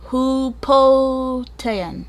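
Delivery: "Hupotean" drawn out slowly, unsure of the name; sounding out. Real, not acted. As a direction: sound out "Hupotean" syllable by syllable, feeling for it.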